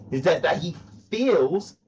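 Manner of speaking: feels slowed and weighted; slow pointed stress on feels. Shown as a demonstration. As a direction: lean into the word feels. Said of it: "emphasis on the word feels".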